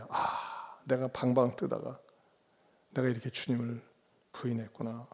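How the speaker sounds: hushed, low acted lament; grieving remorse. Peter realizes he denied the Lord, hushed remorse. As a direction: sink into quiet regret, with a sighed opening. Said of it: "통곡을 하고 울면서 회개했습니다".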